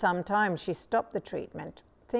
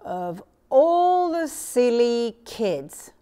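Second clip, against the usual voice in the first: loud, slow scornful jeer; a loud drawn-out jeer; 4 semitones higher, 8 dB louder, much slower than usual. A narrator's reading. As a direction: sneer at the silly kids. Little My scoffing at Ninny.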